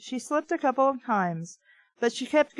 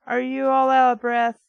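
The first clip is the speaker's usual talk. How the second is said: Andrea's voice; 3 semitones higher, 6 dB louder than usual. Acted. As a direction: call up the question in her voice. Andrea asks from below.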